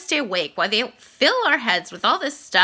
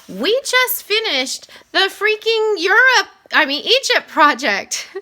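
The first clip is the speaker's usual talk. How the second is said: high, slow, fed-up complaint; exasperated whine; 9 semitones higher, 5 dB louder, slower than usual. Retold or imitated. Boy thinking in class about another project, high exasperated whine. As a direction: groan it out like a kid buried in homework. Kid groaning inwardly at new project.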